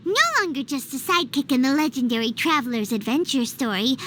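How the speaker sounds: normal talking voice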